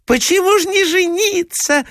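very high, squealed chorus-of-ladies voice; crying out. A narrator's reading. Ladies press the prince from all sides, high insistent cry. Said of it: "закричали со всех сторон".

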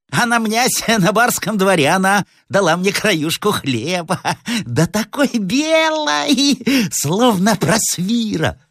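high, cackling village madwoman; slow, high, crazed croak; hoarse madwoman. Acted. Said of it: "сказала охриплым голосом". (полоумная, охриплый высокий голос)

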